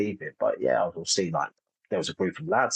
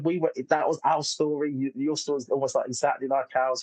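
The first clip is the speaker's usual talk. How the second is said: higher-pitched imitation of an overcome wife; imitating emotional outpouring; 7 semitones higher than usual. Wife hugging the comedian after the show, imitated emotional outpouring. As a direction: act out her gushing, much higher. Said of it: "her doing this outpour".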